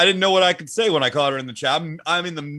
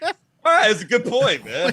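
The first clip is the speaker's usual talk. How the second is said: high mock agreement; 8 semitones higher than usual. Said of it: "I'm over here like".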